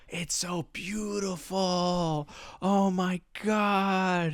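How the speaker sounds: high, soft, drawn-out gushing; mock awe. Students marvelling at what they are shown, mock awe. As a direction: imitate dreamy wonder, stretching the words slowly. Students reacting to pictures, acted out.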